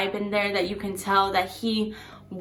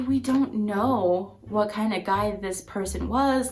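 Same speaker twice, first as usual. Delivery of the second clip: ordinary talk, nothing marked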